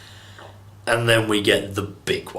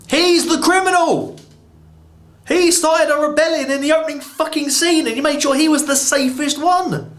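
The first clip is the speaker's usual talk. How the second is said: outraged rant. Real, not acted. Reviewer fuming at the plot hole, high-pitched fast outrage. Ranting about Zod's escape plan.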